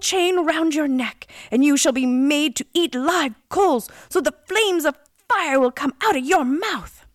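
loud, high, pronouncing a curse; angry storybook prince passing sentence; outraged prince. A narrator's reading. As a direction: thunder out judgment on a villain.

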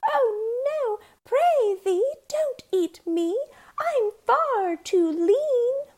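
high, slow wailing little goat; panicked pleading. A narrator's reading. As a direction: plead for your life in a squeaky wail. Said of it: "and pleaded".

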